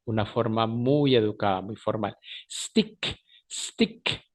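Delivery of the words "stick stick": "Stick" starting straight on a hissed s; no leading vowel. Shown as a demonstration. Teacher drilling students on the English word, crisp vowel-less onset. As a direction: say "Stick" without any e before the s. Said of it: "quitemos ese sonido vocálico de la E".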